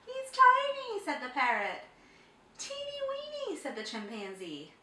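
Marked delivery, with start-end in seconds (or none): 0.1-1.0 high, loud amazed cry; surprised. A narrator's reading. Parrot seeing tiny Big Bro, high amazed cry. 2.6-3.6 very high squeak; squeaky. A narrator's reading. Chimpanzee mocking the tiny mouse, high squeak.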